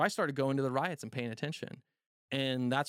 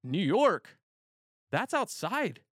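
higher and louder, matter-of-fact correction; higher bartender voice correcting him; bartender's reply; 7 semitones higher, 4 dB louder, much slower than usual. Retold or imitated. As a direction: play the bartender pointing out the window. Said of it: "And he was like".

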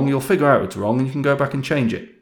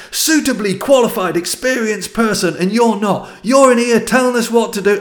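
high, loud angry tirade; furious shouted rant; 11 semitones higher, 5 dB louder than usual. Retold or imitated. Old engineer shouting down the young manager in a meeting, high loud rant. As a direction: let rip at the young upstart. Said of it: "went on at me for about a minute".

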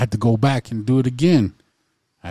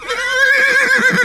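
high, loud pretend neigh; whinny like an excited horse; mock horse whinny; 5 dB louder than usual. Co-host plays along with a gifted horse, mock whinny.

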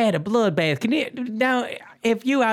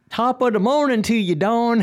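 cheery stage Irish, pitched up a little; Irish accent; 4 semitones higher than usual. Shown as a demonstration. Host showing co-hosts their Irish accent on request, hammed-up Irish. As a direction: attempt a hammed-up Irish greeting. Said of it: "here's my Irish accent".

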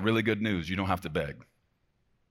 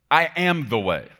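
high, loud and emphatic; bold declaration; 7 semitones higher, 8 dB louder than usual. Acted. Preacher quoting what Jesus told him, bold and loud. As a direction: declare it with full authority. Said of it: "I like when he talks like that".

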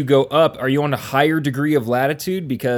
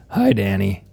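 ordinary delivery

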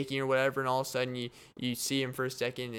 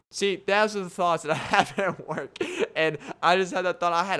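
laughing; 6 semitones higher, 7 dB louder than usual. Real, not acted. Laughing about his daydream.

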